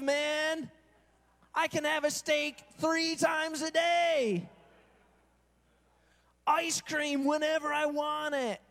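hammed-up cruise lover; gushing cruise fan. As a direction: savour every word like a treat. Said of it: "what do they talk about".

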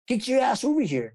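high, gruff trainer voice; imitating the trainer. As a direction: bark it out like a gruff horse trainer. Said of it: "That's how he said it".

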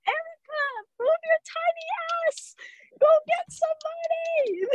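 very high desperate shriek at the screen; frantic plea. Retold or imitated. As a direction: panic like you're begging a character to run. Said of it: "I was sobbing like a bitch".

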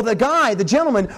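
ordinary talk, nothing marked

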